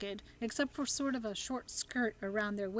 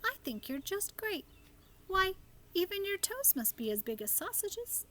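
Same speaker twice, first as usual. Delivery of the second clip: much higher, eager reassurance; comforting girl; 10 semitones higher than usual. A narrator's reading. Sophie comforts the giant, much higher eager girl. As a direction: cheer him up brightly.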